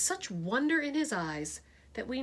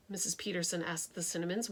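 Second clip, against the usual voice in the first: usual speaking voice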